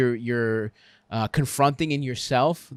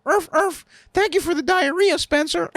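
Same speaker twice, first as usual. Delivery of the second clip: barking like a dog, then grateful in a much higher voice; doglike woman; 3 dB louder than usual. Retold or imitated. To Spencer, mocking his fear of kink, in a high doggy voice. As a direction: yap it out like a collared pet. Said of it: "put it up with a collar around her neck and go".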